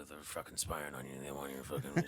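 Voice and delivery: low, muttered conspiracy rant; lightly voiced paranoid neighbor; mock paranoid mutter; paranoid guy impression. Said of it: "He's very, like".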